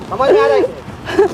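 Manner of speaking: high-pitched, heated challenge; heated, scolding question; angry demand. Real, not acted. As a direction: demand an answer, pitch jumping up. Man confronting the son.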